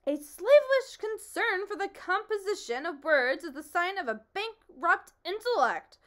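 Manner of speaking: loud, high pompous roar. Acted. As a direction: roar it back in outrage as the humbug. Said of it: "roared the humbug".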